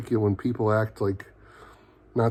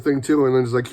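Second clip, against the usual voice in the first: ordinary delivery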